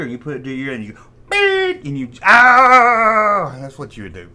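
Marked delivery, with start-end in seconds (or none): 1.3-1.8 a sudden high, loud beep; shrill electronic beep right into the ear; piercing beep. Retold or imitated. 2.2-3.6 a long, loud, higher yell; a slow, louder held yell; drawn-out yell. Retold or imitated. Blasting sound into friend's ear.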